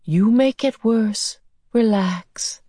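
slow, lifted alien voice; calm her down slowly; unhurried creature giving reassurance; soothing beast. A narrator's reading. Beast soothes struggling girl.

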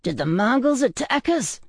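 high, alarmed question; alarmed cry. A narrator's reading. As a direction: blurt it high, startled from sleep. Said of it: "I screamed as my eyes snapped open".

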